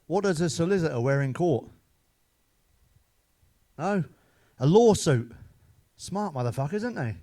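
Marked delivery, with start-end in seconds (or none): 4.6-5.3 punchline hit hard and loud; louder punchline with a grin. Acted. Punchline delivered loudly.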